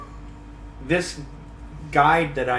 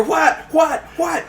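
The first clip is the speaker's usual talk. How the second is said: alarmed dad shouting; 12 semitones higher, slower than usual. Retold or imitated. Alarmed dad runs to son.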